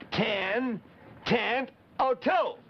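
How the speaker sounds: Ten tent o' tube drawn out, every syllable apart; said slowly. Shown as a demonstration. Spelling the name out for the kids, slowly. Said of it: "listen to it slowly".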